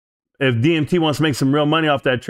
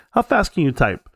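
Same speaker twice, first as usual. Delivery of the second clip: ordinary delivery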